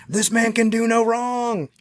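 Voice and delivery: high, slow, dreamy adoration; starstruck devotion. Retold or imitated. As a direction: draw it out like a smitten fan. Total convert after the speech.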